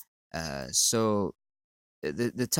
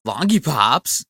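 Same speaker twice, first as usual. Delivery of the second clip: loud, higher cartoon-voice catchphrase; mocking quote; 5 semitones higher, 12 dB louder, slower than usual. Vlogger mocking his fans' catchphrase, loud and goofy. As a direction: belt the silly catchphrase like a kids' show. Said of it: "you think it's fun and great to say".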